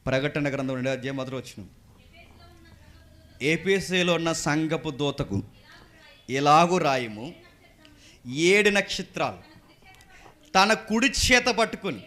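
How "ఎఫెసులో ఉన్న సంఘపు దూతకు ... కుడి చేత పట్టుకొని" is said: slow, solemn reading of the verse; scripture reading. Acted. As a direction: read the verse slowly and weightily. Reads scripture slowly. (నెమ్మదిగా గంభీరంగా వచన పఠనం)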